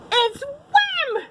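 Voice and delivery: high, terrified shriek; wolf cries out in panic; frightened cry. A narrator's reading. Wolf recognising the monster, crying out in fright. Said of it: "cried Wolf".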